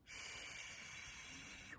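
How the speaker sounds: long soft unvoiced shh; a gentle hushing hiss; shushing sound. Shown as a demonstration. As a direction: hush it out like a quiet librarian. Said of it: "it makes that sound".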